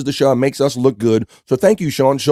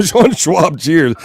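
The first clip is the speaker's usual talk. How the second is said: "Sean Schwab" said through a laugh, higher; laughing reading of "Sean Schwab"; 6 semitones higher, much slower than usual. Real, not acted. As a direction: crack up at the name. Laughs at the wrong name.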